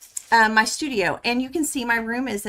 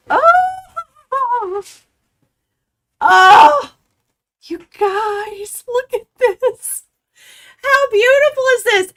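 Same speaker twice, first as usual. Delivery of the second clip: excited squeal; 13 semitones higher, 8 dB louder, slower than usual. Real, not acted. Unboxing a long-awaited quilt kit.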